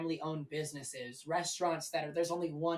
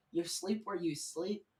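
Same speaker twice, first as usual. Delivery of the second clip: ordinary talk, nothing marked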